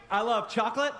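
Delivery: usual speaking voice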